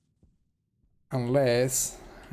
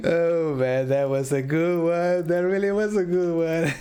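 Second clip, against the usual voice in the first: giggly and louder; words through laughter; laughing while talking; 5 semitones higher, 5 dB louder than usual. Real, not acted. Still laughing at the joke.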